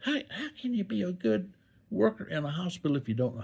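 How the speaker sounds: high-pitched mock urgency; mock earnest. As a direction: play up how vital it is, voice way up high. Mock enthusiasm about falling training.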